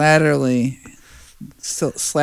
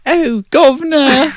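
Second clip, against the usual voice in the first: high, slow put-on cockney accent; mock cockney accent; 5 dB louder than usual. Mocking the actress's slipping accent in hammy cockney. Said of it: "She's like".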